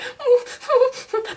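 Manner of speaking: high, loud imitation whimpering; mock whimper. Patient recalling the procedure, mock whimpering. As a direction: re-enact whimpers in short high moans. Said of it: "I'm just whimpering".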